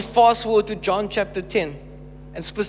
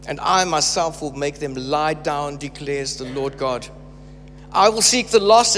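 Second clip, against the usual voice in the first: measured, weighty scripture reading with slowed phrases; 4 semitones lower than usual. Acted. Preacher reading Ezekiel aloud to church, measured proclamation. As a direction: proclaim God's words to the congregation.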